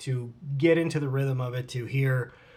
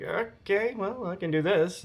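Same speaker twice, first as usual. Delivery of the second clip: higher-pitched, unsure mock reply; hesitant agreement; 5 semitones higher, slower than usual. Actor asked to improvise a kata at audition, hesitant. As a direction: go along with it in a raised, tentative voice.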